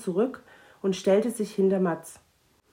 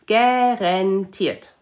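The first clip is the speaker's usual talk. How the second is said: loud, very slow drawn-out word; emphatic dragon; 8 dB louder, much slower than usual. Acted. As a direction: stretch it out proudly.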